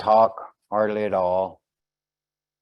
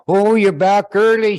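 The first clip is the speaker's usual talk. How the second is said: startled, higher and louder; surprised wife; 9 semitones higher, 10 dB louder than usual. Acted. Wife startled to find husband home early, surprised. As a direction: caught off guard by her husband's early return.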